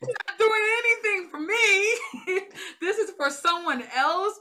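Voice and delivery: sharing her struggle with God with her friends, laughing through tears; laughing near tears. Real, not acted.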